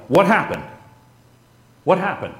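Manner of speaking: high, loud desperate yell. Retold or imitated. Husband yelling in shock on finding his wife gone. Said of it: "in a voice I'm using right now, I yelled".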